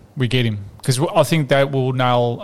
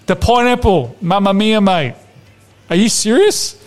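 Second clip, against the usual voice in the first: higher, hammed-up Italian-flavoured tirade; mock outraged rant; 5 semitones higher than usual. Podcast host ranting at Ramsey, hammed-up outrage. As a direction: rant at him like an exasperated nonna.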